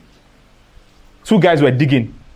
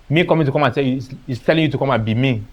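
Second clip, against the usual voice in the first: normal talking voice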